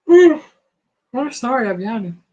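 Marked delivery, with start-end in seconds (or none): 0.0-0.4 loud whew. Real, not acted. Loud exhale after coughing.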